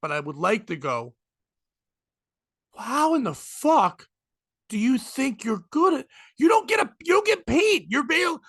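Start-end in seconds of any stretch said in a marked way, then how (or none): 2.8-8.4 incredulous telling-off; exasperated disbelief. Acted. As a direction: pitch climbing into an indignant rant. What he would like to say.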